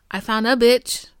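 normal talking voice

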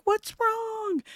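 high-pitched, drawn-out mock kids' voice; imitating kids' question. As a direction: pitch it way up, imitating the kids asking. Kids' worried question, acted out.

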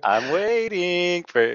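high, drawn-out sing-song; mock expectant. Co-host chiming in on the joke, mock-expectant sing-song. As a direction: playfully stretch it out, hinting for an answer.

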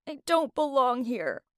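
high, tearful wail on the first part; crying. A narrator's reading. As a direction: wail it as if through tears. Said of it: "I kept crying as I said".

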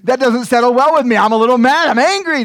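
laughing, loud put-on grumble; hammed-up resentment; mock angry brother. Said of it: "He's like".

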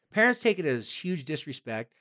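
ordinary delivery

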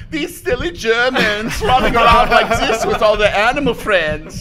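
high, loud silly German voice; mock German cartoon shout. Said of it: "the cartoon versions are like".

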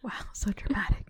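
soft whispered aside; a quiet, impressed murmur; hushed awe. Real, not acted. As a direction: listener whispering, awed by the story. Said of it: "listener reacts".

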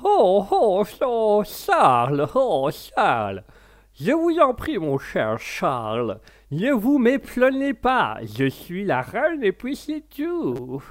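slow, high posh English queen; the Queen of England. Pretend. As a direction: play a haughty English queen. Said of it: "la reine d'Angleterre comme ça".

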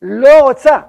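a loud, gut-level roar of refusal. Retold or imitated. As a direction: roar it out as the soul bursting free. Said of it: "שאגה עצומה". (שאגה רועמת של סירוב)

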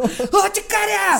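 grito agudísimo de anime; otaku gritando japonés. Acted. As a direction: suelta japonés inventado a todo pulmón. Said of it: "palabras raras en chino". (parodia chillona y fuerte de anime)

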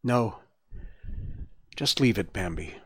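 quiet. A narrator's reading. Weak Gobo refusing Bambi's help, quietly. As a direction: refuse softly, with little strength. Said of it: "answered Gobo quietly".

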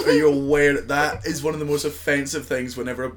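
usual speaking voice

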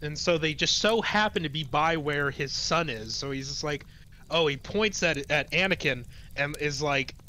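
ordinary talk, nothing marked

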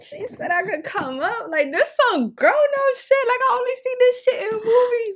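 high, loud giddy laughter; excited laughing. Real, not acted. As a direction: squeal it with delight.

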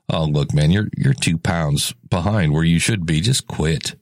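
a hint of a mocking inner critic, pitched low; nasty inner voice. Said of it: "that nasty voice again".

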